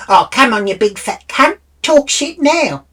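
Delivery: high, loud imitated goading; aggressive taunt. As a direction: mimic someone spoiling for a fight, rising at the end. Acts out woman picking a fight.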